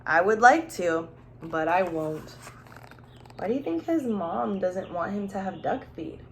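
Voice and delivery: usual speaking voice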